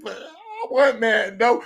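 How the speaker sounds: drawn-out, whiny fake bawling; mock crying. Dad imitating his own sulk over wanting Madden, mock crying. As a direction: wail it like a sulking kid, hammed-up. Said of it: "Crying and shit".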